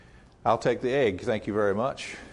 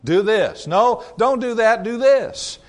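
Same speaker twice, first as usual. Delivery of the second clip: bossy commands, higher and louder; insistent voices; 6 semitones higher, 6 dB louder, slower than usual. Retold or imitated. Preacher mimicking voices pressing on his flock, louder. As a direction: push the listener around like a nagging voice. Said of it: "these voices coming at us all day long".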